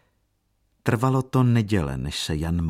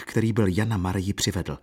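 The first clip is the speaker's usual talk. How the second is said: ordinary delivery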